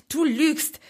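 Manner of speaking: forceful, furious denial; hissed through teeth. A narrator's reading. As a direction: spit out the accusation through clenched teeth. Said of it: "zischte Sasuke zwischen zusammengebissenen Zähnen".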